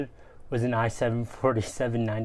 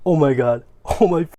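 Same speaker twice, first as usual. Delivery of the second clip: loud excited gasps of delight; thrilled and loud; 4 semitones higher, 11 dB louder than usual. Real, not acted. Gaming laptop discovered.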